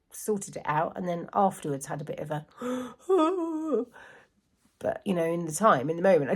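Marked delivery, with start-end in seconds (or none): 2.5-3.8 a sharp high gasp of delayed panic; imitated panicked gasp. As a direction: gasp suddenly like the shock just hit you. Imitating her panic after the event.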